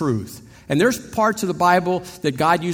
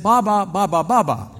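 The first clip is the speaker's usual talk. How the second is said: mock babble. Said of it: "He said".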